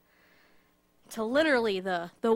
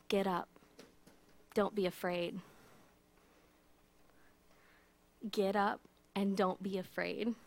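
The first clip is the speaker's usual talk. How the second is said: softer, tender comfort; soft words to frightened friends; gentle reassurance; 3 semitones lower, 8 dB quieter than usual. Acted. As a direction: hush them gently to their feet. Jesus comforting fallen disciples.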